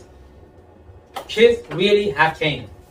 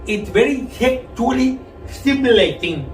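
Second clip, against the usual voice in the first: garbled tongue-stud speech; 5 semitones higher than usual. Retold or imitated. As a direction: talk like you just learned to talk. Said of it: "sounded like somebody who just learned how to talk".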